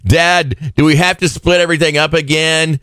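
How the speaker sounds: put-on high whiny child voice; whiny kid. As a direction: whine like a hungry kid. Whiny kid voicing family.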